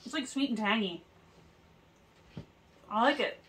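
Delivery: words muffled by chewing; talking while chewing. Real, not acted. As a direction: mouth partly full of cookie. Seen 2: woman holds cookie, jaw working while talking after a bite.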